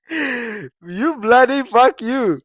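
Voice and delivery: put-on slow drawn-out Indian accent. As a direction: stretch out the curse in an Indian accent. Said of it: "practicing my Indian accent".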